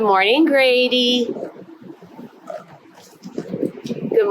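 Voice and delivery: bright sing-song roll call; kindergarten teacher voice. Acted. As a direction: greet each little one warmly. Greets each child by name.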